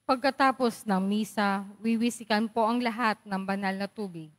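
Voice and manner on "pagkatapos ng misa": normal talking voice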